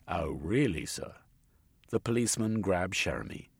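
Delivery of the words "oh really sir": deadpan disbelief; dry sergeant. A narrator's reading. Sergeant repeats.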